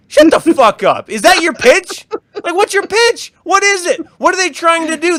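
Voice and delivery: loud, high, disbelieving rant; incredulous outburst. Real, not acted. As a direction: blast the ridiculous idea. Outraged at book idea.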